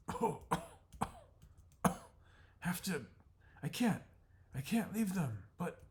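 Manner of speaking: distressed. Acted. Jenny torn leaving the eggs.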